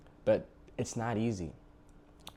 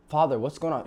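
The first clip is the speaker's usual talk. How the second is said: upset son; 6 semitones higher, 5 dB louder than usual. Retold or imitated. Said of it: "He said".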